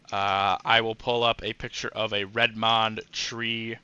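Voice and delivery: ordinary delivery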